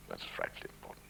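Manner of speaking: voiceless, dropped-away sarcastic aside; dry whispered irony. Real, not acted. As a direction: whisper it as a wry jab at the rule. Mocking the self-announcing rule.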